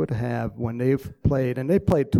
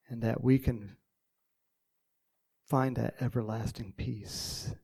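prayer; 6 dB quieter than usual. Real, not acted. Pastor praying over the class, hushed and earnest. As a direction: pray quietly and sincerely. Opening prayer before the lesson.

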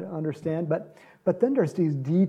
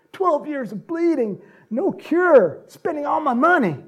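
high, loud pleading; desperate plea; 10 semitones higher, 7 dB louder than usual. Acted. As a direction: plead at his feet in desperation. Woman's desperate plea to Jesus.

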